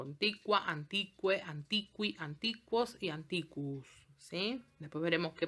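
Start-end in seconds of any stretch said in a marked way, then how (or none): 0.0-3.9 "Antiqua, antiqua, antiqui, antiquos y antiquus" each with kw; Latin QU drill. Shown as a demonstration. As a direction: run through the forms, sounding the U. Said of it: "delante de cualquiera de las vocales".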